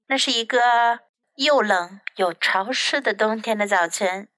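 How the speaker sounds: usual speaking voice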